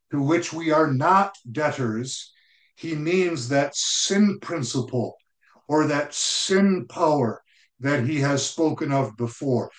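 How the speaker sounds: normal talking voice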